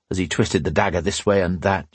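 plain reading voice

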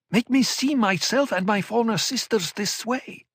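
high, urgent, pleading; desperate insistence; anguished young woman. Acted. Murbella accuses.

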